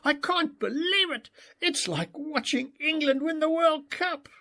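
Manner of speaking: high, thrilled exclaim; can't contain the joy; elated father cheering; jumping and whooping. A narrator's reading. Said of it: "I was jumping up and down, whooping".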